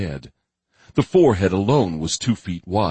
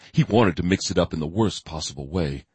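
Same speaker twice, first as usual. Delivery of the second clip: ordinary delivery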